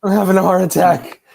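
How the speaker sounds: high, loud panicked quote; mock panic. Friend voicing the nervous driver at burnout pit, mock panic.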